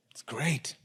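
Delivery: low, breathless whisper; soft reverent whisper fading away; awed whisper. Acted. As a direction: hush it like a precious secret. Reveals Jesus' praise.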